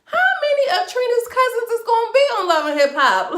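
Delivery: high, loud disbelief with a laugh; laughing in shock at yet another cousin; incredulous. Retold or imitated. Reacting to Trina's cousin, loud laughing disbelief. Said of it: "I'm like".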